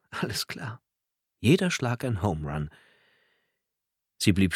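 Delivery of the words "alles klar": quiet laugh, whispered words; soft laugh. Acted. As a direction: chuckle it under your breath. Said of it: "lachte leise". (leise lachend)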